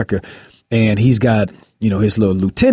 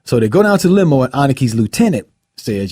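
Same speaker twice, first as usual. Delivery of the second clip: ordinary talk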